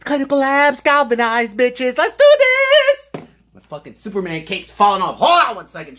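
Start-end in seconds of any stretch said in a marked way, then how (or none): 0.0-2.0 high, loud and stretched-out hype; hyped comeback. Real, not acted. Returns hyped after intro. 2.0-3.1 high, loud and slow, stretching out "do"; hyped. Real, not acted. Hyped start of test.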